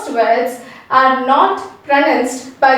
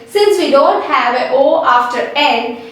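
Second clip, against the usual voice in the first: ordinary talk, nothing marked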